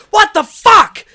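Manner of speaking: high, very loud startled shout; retold scream. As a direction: scream it as if jolted awake. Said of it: "when I screamed at him".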